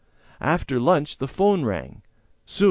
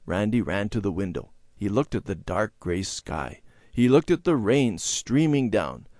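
ordinary delivery, nothing marked

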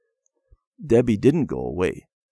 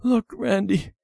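put-on high, tearful little-girl voice; crying; 12 semitones higher than usual. A narrator's reading. Debbie confessing to Randy about the broken truck, crying. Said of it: "She was crying".

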